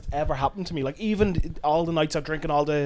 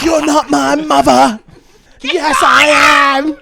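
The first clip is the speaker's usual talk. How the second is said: EastEnders drama; 7 semitones higher, 9 dB louder, slower than usual. Acted. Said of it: "like characters from EastEnders".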